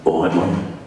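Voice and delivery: low, gruff cockney geezer; Mitchell Brothers. Shown as a demonstration. Geezer greeting his mum, low gruff cockney. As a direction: drop down into a gruff cockney accent. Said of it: "think early Mitchell Brothers".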